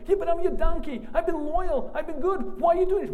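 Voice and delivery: very high, loud squealing plea; the hurt donkey begging its master; pleading donkey. Acted. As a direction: loyal donkey wailing at its master. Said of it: "The donkey opens its mouth and says".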